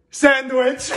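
laughing punchline. Acted.

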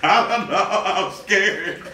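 nervous giggle while speaking; nervous laughing. Real, not acted. Nervous before a big challenge.

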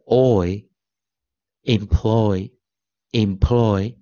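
OY said "oi", then "employ" drawn out slowly; sounding out. Shown as a demonstration. Teacher sounding out O Y for students, slow pronunciation model. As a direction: model "employ" slowly, with a long "oi" in the second syllable. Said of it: "那O Y就念oi".

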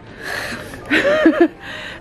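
high, airy laugh; breathy laugh. Real, not acted. Laughing at herself.

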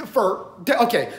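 ordinary talk

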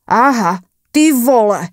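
loud call down the corridor; shouting into the empty corridor; shouting down the hall. A narrator's reading. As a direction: yell it out into the hallway. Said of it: "zakřičel nahlas do chodby".

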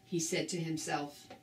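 usual speaking voice

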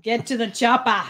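loud mock action-hero accent; Arnold. Reading a viewer's suggested line, loud action-hero impression. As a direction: call it out as the Austrian action star. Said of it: "They're asking for Arnold".

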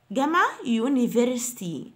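ordinary talk

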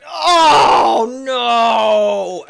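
loud, drawn-out high wail of dismay; dismayed shout. Real, not acted. Player to the game as a perfect run fails, dismayed shout. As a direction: cry out as it all goes wrong. Game suddenly goes wrong.

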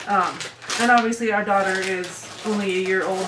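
ordinary delivery

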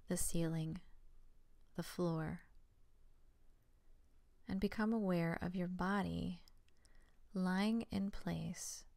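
soft, unhurried meditation voice with long pauses; quiet, even yoga nidra instructions; guided meditation. Real, not acted. Leads the guided relaxation.

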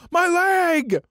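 very high-pitched cartoon yell; sudden high, drawn-out cartoon cry of pain; SpongeBob character yell. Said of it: "like that guy on SpongeBob who yells".

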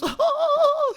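high, gleeful prank giggle; high-pitched troll laugh; mischievous laugh. Acted. Prankster laughs after fooling Tarık.

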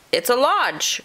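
high, perky animal voice; muskrat calling. A narrator's reading. Muskrat to possum about its house, calling. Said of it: "called the muskrat".

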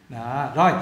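ordinary delivery

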